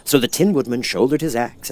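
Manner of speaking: ordinary delivery, nothing marked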